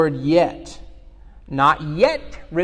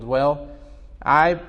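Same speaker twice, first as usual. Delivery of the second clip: ordinary delivery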